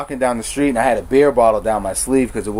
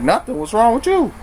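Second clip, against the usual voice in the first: snapping back; 11 semitones higher than usual. Retold or imitated. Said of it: "I'm like".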